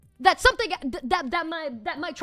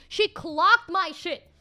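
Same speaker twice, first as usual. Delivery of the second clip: louder, slow, punched delivery; emphatic; 4 semitones higher, 5 dB louder, much slower than usual. Real, not acted. Storyteller telling viewers the psychic read her, loud and slow. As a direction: hit every word slowly and hard.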